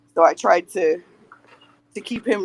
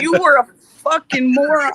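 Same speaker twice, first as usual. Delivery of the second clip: put-on louder and higher, scolding his old self; playful outburst of self-blame, raised high; mock self-scolding yell; 7 semitones higher, 6 dB louder than usual. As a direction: yell at the dumbass you used to be, half joking. Scolding own younger self.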